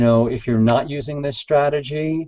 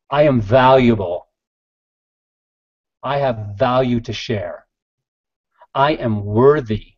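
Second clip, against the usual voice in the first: passionate affirmation. Shown as a demonstration. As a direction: declare each line with conviction, pausing between them. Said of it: "you say it like that with passion".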